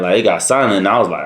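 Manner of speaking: ordinary delivery, nothing marked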